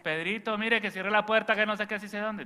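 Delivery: high, loud mock shout at Pedrito; yelling grandmother. Grandmother interrupts her rosary to shout at Pedrito about the door, loud high mock yell. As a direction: holler the order down the hall. Grandmother yells mid-prayer.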